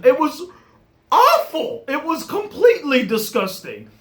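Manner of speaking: disgusted rant. Real, not acted. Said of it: "ranting about bad CGI".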